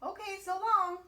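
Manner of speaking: high, loud cheery goodbye; tumbleweed bouncing away happily; cheerful. A narrator's reading. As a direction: toss off a bright so long. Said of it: "said Rosie cheerfully".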